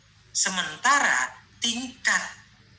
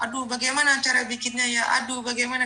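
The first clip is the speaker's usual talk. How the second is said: higher, hurried mock fluster; meniru orang kebingungan; 6 semitones higher, faster than usual. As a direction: imitate someone stumbling along, high-pitched and fretting. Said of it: "Kayak orang kesandung aja". (pura-pura bingung, seperti orang kesandung)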